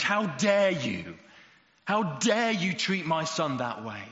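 slow, loud, outraged shout; furious shout. Retold or imitated. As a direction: roar it at the bully, father defending his son. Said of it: "quaking in rage".

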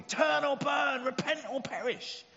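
high, slow, loud megaphone yell; street preacher shout. Retold or imitated. Street preacher yelling at crowds at the crossing, shouting. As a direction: bellow it at the crowd like a doom prophet. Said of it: "he's shouting at everybody".

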